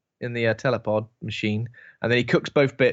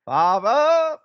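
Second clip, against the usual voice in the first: sudden high shout; shouted "Father!"; 10 semitones higher, 6 dB louder than usual. Retold or imitated. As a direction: bellow it out high. Mocking Douglas Reynholm outburst.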